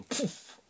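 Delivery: sharp unvoiced puff sound; a scornful little puff; mocking pouf.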